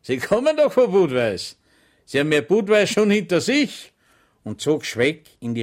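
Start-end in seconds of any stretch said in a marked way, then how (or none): 0.0-3.9 higher, louder mocking policeman; sarcastic gendarme. Gendarme scoffing at Schweig, higher and louder. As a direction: sneer at his excuse. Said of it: "lachte sarkastisch".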